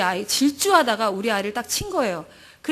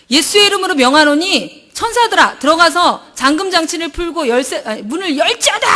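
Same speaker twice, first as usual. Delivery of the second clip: loud, high proclamation in Jesus's name; commanding shout; 5 semitones higher, 7 dB louder than usual. Retold or imitated. Commanding angels to unlock the stuck door, a loud proclaiming shout. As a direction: command the angels with full authority. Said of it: "하고 소리 질렀어요".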